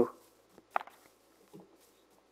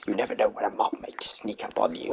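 whispering; 7 dB quieter than usual. Real, not acted. Warning partner while gaming.

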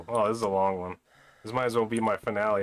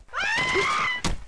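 high sudden yelp of fright; startled shriek; 4 dB louder than usual. Real, not acted. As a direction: yelp at the scare. Jump scared again.

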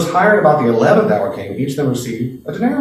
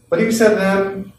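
ordinary talk

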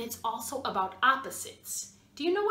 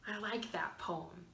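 whisper; 4 semitones lower, 12 dB quieter than usual. Real, not acted. Soft aside after poem.

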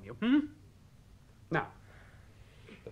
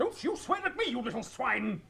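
angry scolding; 10 semitones higher than usual. Acted. Choirmaster scolding the boy.

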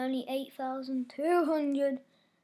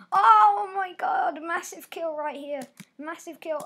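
excited cry; 5 semitones higher, 10 dB louder than usual. Real, not acted. Big kill, excited.

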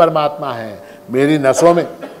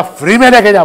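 loud, higher angry retort; angry shout; 4 semitones higher, 6 dB louder, faster than usual. Retold or imitated. Furious shopkeeper telling customer take it free, angry shout. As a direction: snap it out in anger. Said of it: "इतना गुस्सा आ गया दुकानदार को".

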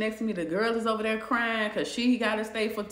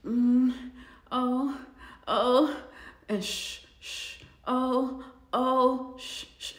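imitated bunkmate noises; 3 semitones higher, much slower than usual. Overheard from the bunk next door, hushed moans. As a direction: mimic the muffled moaning and shushing. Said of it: "I'm hearing".

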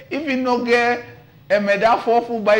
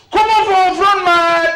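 calling out loud; 7 semitones higher, 5 dB louder than usual. Acted. Calling artist to the front.

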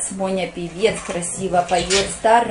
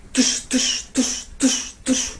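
gun sounds; 3 semitones higher, 4 dB quieter, slower than usual. Shown as a demonstration. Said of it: "Как Моня стреляет?".

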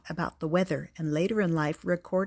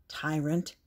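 low-key mutter, slightly softer; muttered under breath; 3 semitones lower, 5 dB quieter than usual. A narrator's reading. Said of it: "muttered George under his breath".

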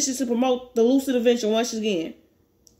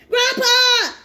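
high, loud cry of Grandpa!; 11 semitones higher, 5 dB louder than usual. Acted. Grandson crying out to his dying grandfather, high and loud. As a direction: call out desperately, high and loud.

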